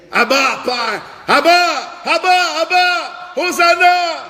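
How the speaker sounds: high, loud cheering calls; crowd acclaim shout. Shown as a demonstration. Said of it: "la foule criait". (acclamer comme la foule, haut et fort)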